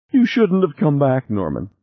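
crying. A narrator's reading. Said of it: "she began crying again".

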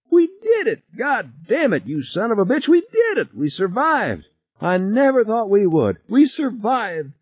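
very high, jubilant voice without much volume; elated screaming. A narrator's reading. Passenger pounding pilot on the back, high elated screaming. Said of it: "Harry was screaming".

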